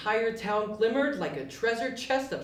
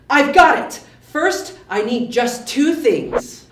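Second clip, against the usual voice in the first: much louder eureka exclamation; excited; 3 semitones higher, 11 dB louder, slower than usual. Acted. Yubi thrilled, announcing his plan loudly. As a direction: burst out with the idea. Yubi's eyes lit up.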